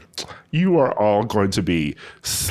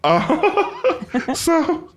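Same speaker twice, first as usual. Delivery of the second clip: laughing. Real, not acted. Laughing before the news.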